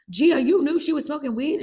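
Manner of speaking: high, loud accusing question; outraged mom. Retold or imitated. Mom confronts Gia about the weed, outraged. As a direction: imitate a mother scolding in disbelief.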